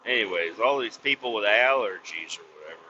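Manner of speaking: ordinary delivery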